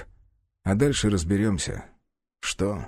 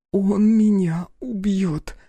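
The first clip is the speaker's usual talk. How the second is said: ordinary delivery, nothing marked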